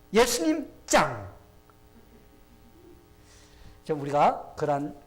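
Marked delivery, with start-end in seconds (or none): 0.1-1.3 high shout dropping onto a punchy last word; loud, exuberant acclaim; exclaiming in admiration. Shown as a demonstration. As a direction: cheer like a thrilled fan. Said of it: "보면서 감탄합시다".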